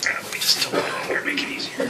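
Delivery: soft whispered aside; whispering. Real, not acted. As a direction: whisper it to yourself. Aside while tilting pan.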